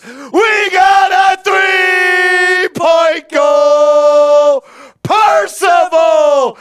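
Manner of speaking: drawn-out, loud, high celebration call; hype shout. Acted. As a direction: scream it like a game-winner. Huge three-point celebration call.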